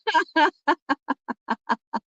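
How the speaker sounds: high real laugh. Real, not acted. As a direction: burst of laughter. Big laugh.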